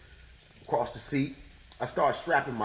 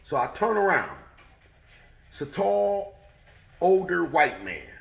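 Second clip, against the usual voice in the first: ordinary talk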